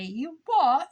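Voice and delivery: put-on a jokey Brit going "You what?" in an English accent; mock English accent. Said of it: "I like to pretend like I'm from England".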